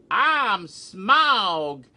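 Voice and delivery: loud, high Southern dragon announcing itself; hammed-up genteel Southern accent. Puppet dragon introducing itself on stage, mock Southern bellow. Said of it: "you have a genteel southern accent".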